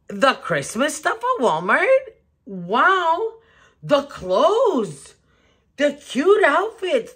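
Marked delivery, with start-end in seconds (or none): 2.4-7.1 high, excited wow; amazed. Real, not acted. Telling viewers about Walmart's Christmas decor, high amazed wow. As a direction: gasp it out, dazzled by the displays.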